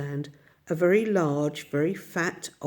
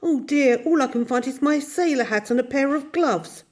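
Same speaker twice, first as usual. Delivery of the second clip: put-on flustered boy; 7 semitones higher, 3 dB louder than usual. Michael flustered in the dark.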